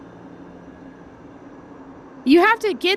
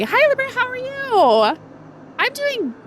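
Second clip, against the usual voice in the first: high greeting voice; 14 semitones higher than usual. Real, not acted. Greeting a chat viewer.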